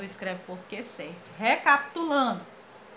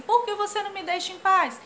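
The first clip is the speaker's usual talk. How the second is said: high quoted line; 9 semitones higher, 5 dB louder than usual. Retold or imitated. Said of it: "Quando ele diz assim".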